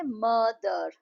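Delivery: मदर split into two drawn-out syllables, म and दर. Shown as a demonstration. Teacher showing a two-syllable word, syllable by syllable. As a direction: say मदर slowly in two separate beats. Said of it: "टू syllables के words कैसे हैं".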